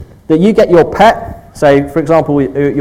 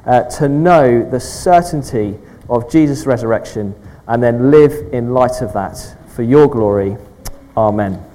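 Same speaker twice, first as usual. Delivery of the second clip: hushed church prayer; 4 semitones lower than usual. Acted. Leads congregation in prayer.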